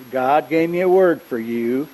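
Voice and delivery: slow Southern drawl, imitating a friend; lightly imitated slow Southern voice; real Southern. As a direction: draw it out like a Southern preacher friend. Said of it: "It's real Southern".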